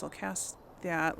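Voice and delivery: normal talking voice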